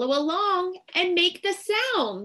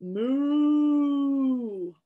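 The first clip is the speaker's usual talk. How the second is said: long, sustained moo; cow moo. Pretend. Reader plays cow for the children, mooing. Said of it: "Moo like a cow".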